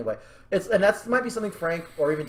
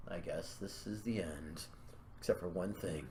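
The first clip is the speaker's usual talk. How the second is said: quiet resignation; 10 semitones lower, 18 dB quieter than usual. Acted. He accepts the end as she holds him, quiet resignation. Voices resigned character at ending.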